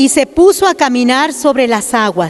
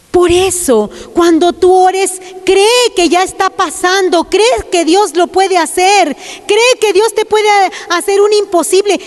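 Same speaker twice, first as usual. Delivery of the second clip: higher, rising preacher's exhortation; fervent preaching; 5 semitones higher than usual. Acted. Preacher urging the sisters to trust God, fervent exhortation. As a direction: lift them up into belief.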